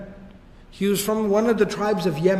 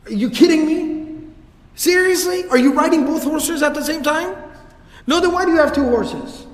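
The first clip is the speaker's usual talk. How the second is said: high, loud disbelieving rant at the horseman; mock outrage, voice up and loud; hammed-up scolding of a show-off; exasperated outburst; 8 semitones higher, 4 dB louder than usual. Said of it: "he would just lose it".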